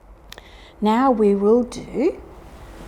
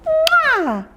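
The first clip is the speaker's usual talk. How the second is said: a very high squealed air kiss; a squeaky kiss for the babies; kiss sound. Shown as a demonstration. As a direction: blow it up high and sweet. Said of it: "And another kiss".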